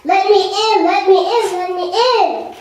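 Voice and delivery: booming repeated let me in; loud demand. Acted. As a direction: pound the words like fists on a door. Mouse demanding at door.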